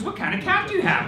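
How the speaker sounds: high, eager teacher voice; imitated teacher. Cat-loving teacher quizzing two boys, high eager imitation. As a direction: mimic a cat lover's keen question.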